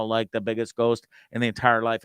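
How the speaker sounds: ordinary talk, nothing marked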